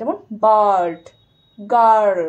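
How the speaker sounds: teacher stretching the er; long er demo. Shown as a demonstration. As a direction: drag the vowel in বার্ড, গার্ল. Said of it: "অনেকক্ষণ ধরে আটা উচ্চারণ হয়".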